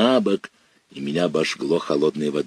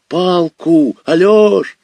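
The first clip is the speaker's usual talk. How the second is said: much higher, much louder shout for help; desperate call; 11 semitones higher, 8 dB louder, slower than usual. Acted. Boy in the river shouts to his brother, desperate scream. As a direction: scream to the shore for a stick.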